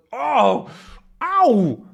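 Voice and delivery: high sharp ow of pain; pained yelp. Acted. Haddock's beard caught in the helmet, high yelp. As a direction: yelp as it pinches.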